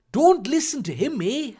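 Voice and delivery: put-on high Canadian accent slipping into Irish; lilting, Irish-sounding Canadian accent; botched Canadian accent. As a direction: attempt Canadian and land in Irish. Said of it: "she's Irish now".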